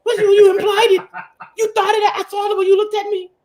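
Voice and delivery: hammed-up wife blaming her husband; mock whiny woman. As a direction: keep up the hammed-up wife accusation. Continuing mock woman accusing.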